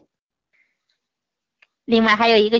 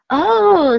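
high, louder wonder; amazed oh; 8 semitones higher, 6 dB louder than usual. A narrator's reading. Cat and mouse seeing the stars, amazed. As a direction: gasp in wonder at the moon. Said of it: "said the cat and the mouse".

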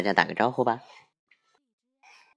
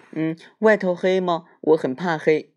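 scared little bear; 8 semitones higher, 5 dB louder than usual. Acted.

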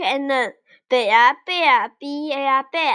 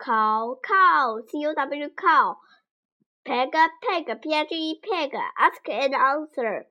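usual speaking voice

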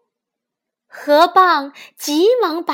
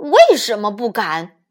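lightly voiced tiger, lower pitch; tiger's retort; 4 semitones lower than usual. A narrator's reading. Tiger retorting to the cornered fox, lightly voiced low retort. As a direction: answer back low as the tiger. Tiger answers the fox's challenge.

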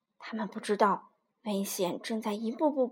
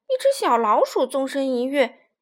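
ordinary delivery

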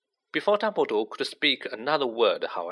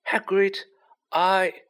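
high-pitched, lightly voiced old wizard; headmaster trying to interject; 9 semitones higher, slower than usual. A narrator's reading. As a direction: try gently to cut in. Voices Dumbledore interrupting Hagrid.

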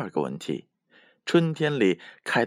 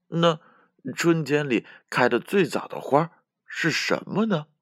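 higher, wondering character voice; pondering giraffe; 7 semitones higher than usual. A narrator's reading. Giraffe asking everyone which flower is first, wondering. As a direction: ask the group, puzzling it out. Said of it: "长颈鹿挠挠头问大家".

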